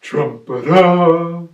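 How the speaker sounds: big ponderous elephant, projected; trumpeting elephant. Acted. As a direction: announce it loudly as a big elephant, stretching the words. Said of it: "The elephant knew just what to do".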